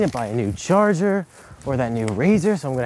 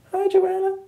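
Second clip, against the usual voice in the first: squeaky-high practice greeting, far above usual voice; too high. Pretend. Man practicing his greeting for Joanna, too high. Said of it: "Too high".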